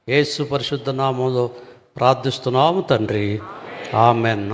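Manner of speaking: hushed, low address to God; prayer. Real, not acted. Leads opening prayer.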